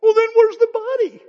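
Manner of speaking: high, loud, insistent demand; Mary pressing the stranger for the body; voicing Mary.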